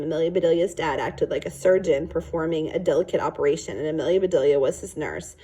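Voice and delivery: plain reading voice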